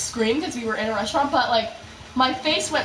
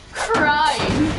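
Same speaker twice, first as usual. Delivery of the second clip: CRYING drawn out, louder; emphatic repeat; 3 semitones higher, 4 dB louder than usual. Retold or imitated. Stressing how badly the jalapeno hurt, emphatic. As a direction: lean hard on CRYING, stretched out.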